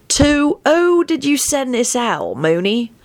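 slow, pointed question; character voice. A narrator's reading. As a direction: press him slowly. Sirius character voice.

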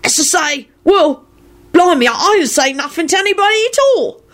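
high-pitched blustering character voice; indignant denial. A narrator's reading. Mundungus insisting he told nobody, blustering. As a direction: protest innocence in a high squawk. Mundungus keeps protesting innocence.

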